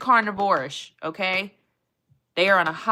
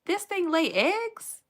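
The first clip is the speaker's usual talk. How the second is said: high, slow disbelieving question; incredulous; 10 semitones higher, slower than usual. Real, not acted. Streamer reading the echidna facts to chat, incredulous. Disbelief at egg-laying fact.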